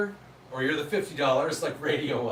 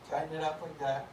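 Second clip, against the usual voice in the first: muffled behind a gas mask; through a gas mask; 8 semitones higher, 6 dB louder than usual. Real, not acted. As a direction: voice trapped inside a mask. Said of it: "So I'm gonna put on the gas mask".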